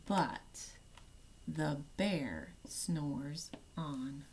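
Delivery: slow hushed refrain; hushed whisper. A narrator's reading. Reading to children, whispering so the bear sleeps on.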